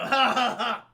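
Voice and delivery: loud, jeering fake laugh; imitated rowdy guffaw; mock party laughter. Partying neighbors ignoring her plea, loud mock laughter.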